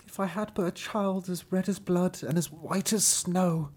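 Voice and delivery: high, wistful acted wish; sighing storybook woman. A narrator's reading. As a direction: voice the woman wishing, pitched well up. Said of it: "sighed heavily".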